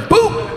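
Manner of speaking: a high quick boop; short mock kick noise; imitated kick sound. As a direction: pop it like a kick on the seat.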